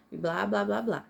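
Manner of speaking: ordinary talk, nothing marked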